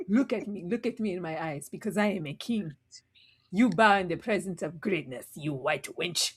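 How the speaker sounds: low, soft pompous king; mock king voice. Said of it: "She's just like".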